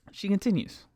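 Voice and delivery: "She continues" in one high, falling sweep; brisk exasperated repeat. Real, not acted. Storyteller moving on with passenger's complaint, "She continues" said undrawn and high. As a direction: say "She continues" without stretching it, pitched high.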